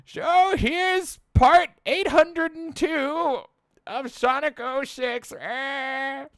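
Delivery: very high, slow put-on voice with slushy S sounds; imagined worn-out host. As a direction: draw it out way up high, ending on a long "eh". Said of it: "I'm gonna be like".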